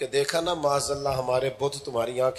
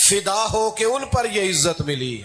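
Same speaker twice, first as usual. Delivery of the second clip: fervent preaching; 8 semitones higher, 3 dB louder than usual. Real, not acted. Preacher glorying in praise of the Prophet, high fervent proclamation. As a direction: proclaim the verse with climbing fervour.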